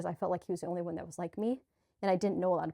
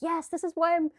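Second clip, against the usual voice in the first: much higher, louder joyful inner voice; lightly voiced beaming higher self; delighted higher self; 8 semitones higher, 5 dB louder than usual. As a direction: gush like a thrilled higher self who loves the challenge. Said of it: "My higher self was probably like".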